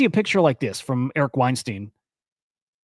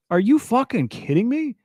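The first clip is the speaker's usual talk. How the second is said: outraged disbelief; 8 semitones higher than usual. Real, not acted. Outraged reaction to the quote.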